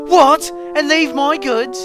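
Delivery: high, loud outraged disbelief; furious protest. A narrator's reading. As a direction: explode at being given the wrong job. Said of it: "Mike went redder than ever with fury".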